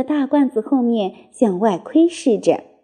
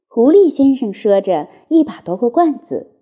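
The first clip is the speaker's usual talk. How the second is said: plain reading voice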